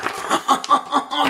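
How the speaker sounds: high staccato cackle; laughing. Real, not acted. Storyteller amused by his grasshopper tale, high cackle. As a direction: burst into high ha-ha-has.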